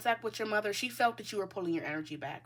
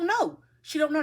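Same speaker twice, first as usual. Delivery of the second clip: louder and higher, insisting; excited insistence; 7 semitones higher, 7 dB louder than usual. Real, not acted. Reader firing up about viewer's mother. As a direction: push it up with heat.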